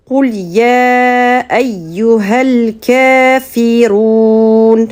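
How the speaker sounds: récitation psalmodiée, lente et tenue; réciter le verset en tajwid, voyelles allongées; récitation coranique. Shown as a demonstration. (cantillation lente du verset)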